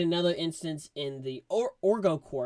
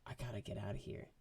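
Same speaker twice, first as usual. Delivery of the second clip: much softer, fast; quiet, hurried desperate line; despairing mutter; 6 semitones lower, 14 dB quieter, faster than usual. Retold or imitated. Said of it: "and he's like".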